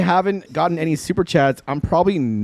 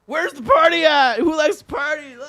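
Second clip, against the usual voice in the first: party voice; 11 semitones higher than usual. Retold or imitated.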